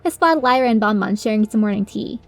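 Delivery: ordinary delivery, nothing marked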